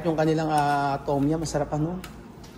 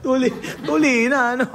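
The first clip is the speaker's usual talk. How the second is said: laughing; 9 semitones higher, 6 dB louder than usual. Real, not acted. Friends joking at the meal.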